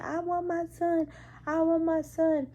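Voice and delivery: high, repeated mock plea; insistent patient wail. Patient who keeps asking for her son, high insistent imitation.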